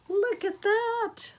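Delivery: very high, slow gasp of delight. Acted. Grandma gasping with delight for the kids. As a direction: marvel at the page.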